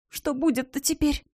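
frightened hushed question; trembling whisper. Acted. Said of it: "дрожащим шёпотом проговорила она". (испуганный дрожащий шёпот)

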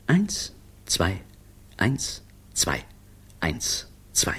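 march count trailing away to a whisper; measured count fading into the distance. A narrator's reading.

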